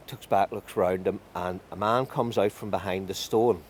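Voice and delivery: usual speaking voice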